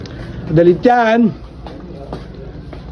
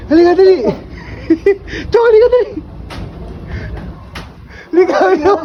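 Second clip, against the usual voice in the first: calling out; 9 semitones higher, much faster than usual. Real, not acted. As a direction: call him over urgently. Calling someone over loudly.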